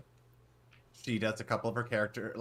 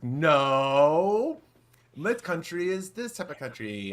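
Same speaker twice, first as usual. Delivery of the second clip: mock European accent, a loud higher "No" then slower; vague European accent; 7 semitones higher, 7 dB louder, much slower than usual. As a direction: imitate a vague European host correcting the crowd. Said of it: "a vague European accent".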